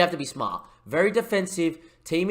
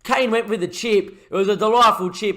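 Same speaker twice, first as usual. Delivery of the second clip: louder commentary burst at the big save; excited save call; 8 semitones higher, 8 dB louder than usual. Real, not acted. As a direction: sell the save like a big moment. Shot saved, commentator erupts.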